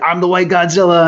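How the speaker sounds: high, loud mock drunken scream; imitated scream. Telling friend his words while smashing register, imitated scream. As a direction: holler it like a rampaging drunk. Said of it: "you were just screaming".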